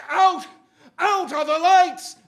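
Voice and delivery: a full-throated high shout; dramatic shout. Acted. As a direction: cry it out to the back row. Climax of dramatic poem reading.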